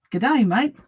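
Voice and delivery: a quick, hammed-up Aussie greeting; dodgy Australian accent. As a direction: toss off a bad Australian accent for fun. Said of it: "Sorry for the dodgy accent".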